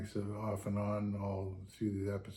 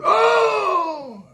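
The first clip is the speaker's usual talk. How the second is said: a sudden much higher, louder yelp of pain; pained shout; 14 dB louder than usual. Retold or imitated. Driver pranking the captain, a fake shout of pain. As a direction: cry out as if the knife went in. Driver fakes cutting finger.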